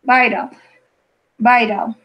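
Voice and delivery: "Vital" said as "vai-dal", the T flapped like an R; teacher models vital. Shown as a demonstration. Teacher models "Vital" for the student, "vai-dal". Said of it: "repeat with me, please, the vital".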